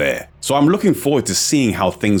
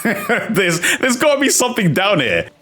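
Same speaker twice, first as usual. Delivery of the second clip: laughing; 12 semitones higher than usual. Real, not acted. Laughing at the dead end.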